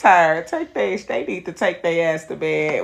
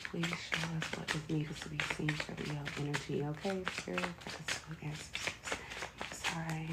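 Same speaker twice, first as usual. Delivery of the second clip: soft, hushed invocation, much softer; quiet prayer; 13 dB quieter than usual. Acted. Reader calling on spirit guides, hushed prayer. Prays to Spirit before a pull.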